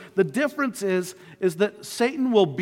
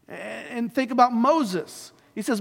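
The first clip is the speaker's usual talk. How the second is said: ordinary talk, nothing marked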